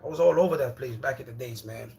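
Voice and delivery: ordinary talk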